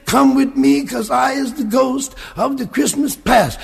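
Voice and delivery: ghost's summons. Acted. Ghost of Christmas past speaks.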